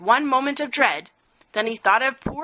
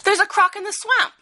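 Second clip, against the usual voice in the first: high, louder bird voice; hornbill voice; 10 semitones higher, 3 dB louder than usual. A narrator's reading. Hornbill warning the animals about the swamp, high. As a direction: squawk out the news. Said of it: "said the bird with the bill".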